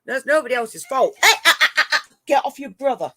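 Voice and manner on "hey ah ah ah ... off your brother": high, loud, laughing through the telling-off; laughing scold. Real, not acted. Owner laughing as she pulls the cat off his brother.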